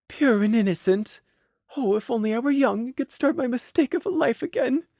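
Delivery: high, soft, wistful lament; despairing thoughts. Acted. Mare silently regretting her life on seeing a child, despairing. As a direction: sigh it softly in regret. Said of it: "but her thoughts despaired".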